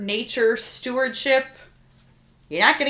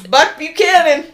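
loud, higher burst on the name; hammed-up name call; 7 semitones higher, 9 dB louder than usual. Co-host relishing the Southern name, hammed-up.

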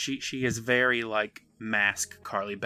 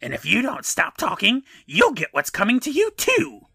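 rising high, loud menacing threat; creepy mask voice; 11 semitones higher, 5 dB louder than usual. Retold or imitated. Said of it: "Carly Beth continues".

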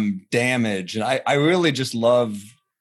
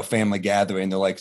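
normal talking voice

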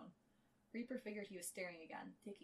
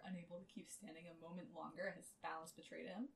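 ordinary talk

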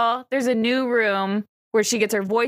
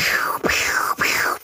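whispered firework sounds; much slower than usual. Shown as a demonstration.

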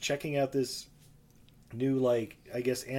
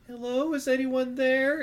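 calling out; 10 semitones higher, 5 dB louder than usual. Acted. Calling out into vault.

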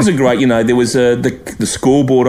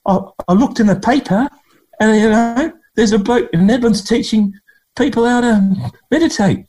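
imitating Langer; 13 semitones higher than usual. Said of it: "and he said".

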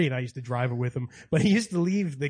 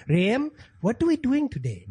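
high, slow British-Indian-New York blend; mixed British-Indian-American accent; 8 semitones higher than usual. Acted. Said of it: "that's how he talks".

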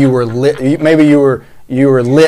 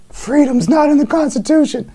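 much higher, sneering mock quote; 13 semitones higher than usual. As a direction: parrot her claim in a silly voice. Mocking the quoted claim.

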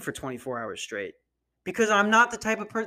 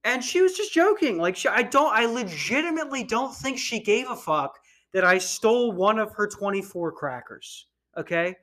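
usual speaking voice

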